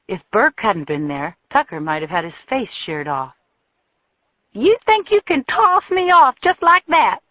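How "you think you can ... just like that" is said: shrill, much higher furious shout; furious woman. A narrator's reading. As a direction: hurl it at him, loud and high. Jilted woman attacks Tucker.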